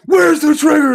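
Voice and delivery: high, loud mock Arnold yell; Arnold impression. Friend shows the Predator voice, loud mock yell. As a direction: bark it like an action hero. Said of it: "You can't do that voice".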